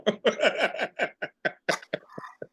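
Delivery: long high-pitched laugh; laughter. Real, not acted. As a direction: burst out laughing at yourself. Laughs at his own plea.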